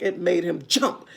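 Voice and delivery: ordinary delivery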